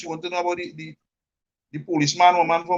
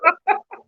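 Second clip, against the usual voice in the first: high-pitched real laugh; laughter; 13 semitones higher than usual. Real, not acted. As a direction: crack up in a high voice. Laughs at the denial.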